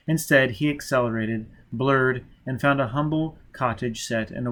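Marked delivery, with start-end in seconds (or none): none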